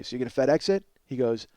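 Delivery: normal talking voice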